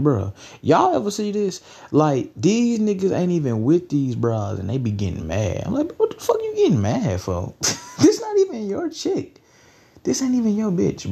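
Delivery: high-pitched, disbelieving protest; incredulous retort. Retold or imitated. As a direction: question them in a high, amused voice. Acting out his incredulous reply.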